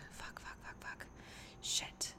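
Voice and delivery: whispering. Retold or imitated. Panicked realisation after disappointing date.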